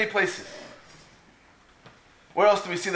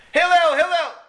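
high, loud mock scream; imitated shrieked summons; 10 semitones higher, 5 dB louder than usual. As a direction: yell for him like a pest at the door. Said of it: "he's screaming".

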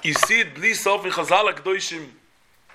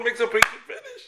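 ordinary talk, nothing marked

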